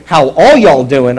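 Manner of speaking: loud, slow Texan hello, leaning on ALL; Texan greeting to a whole family, stretching "all"; Texan plural greeting. Shown as a demonstration. Pastor asking after your whole family, Texan plural greeting. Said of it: "your whole family when I say".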